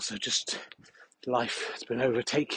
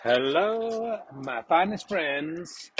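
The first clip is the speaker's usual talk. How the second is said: slow, grandly drawn-out welcome; grand greeting; 3 semitones higher, much slower than usual. Acted. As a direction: welcome them like royalty. Comic podcast greeting.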